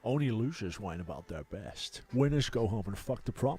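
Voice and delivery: Scottish film-star impression; imitated Scottish accent, played for laughs; Sean Connery. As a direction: put on a Scottish star's voice, swelling on the last line. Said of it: "That is one of your best impressions".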